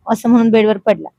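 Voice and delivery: plain reading voice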